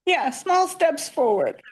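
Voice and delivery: high, choked-up teary voice; holding back tears, laughing through them. Real, not acted. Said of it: "I'm sorry, I'm teary".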